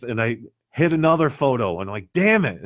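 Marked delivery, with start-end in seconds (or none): 2.1-2.6 high sharp exasperated cry; annoyed curse. Retold or imitated. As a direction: snap it out, frustrated. Second misfire while sledding.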